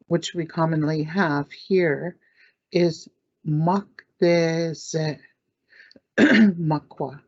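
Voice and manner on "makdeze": "makdeze" very slowly, syllable by syllable; slow pronunciation. Shown as a demonstration. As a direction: stretch "makdeze" out for learners.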